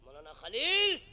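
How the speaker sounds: loud, rising call; hammed-up wife calling out a name; complaining wife. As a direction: holler for the organiser in complaint. Acts wife calling the organiser.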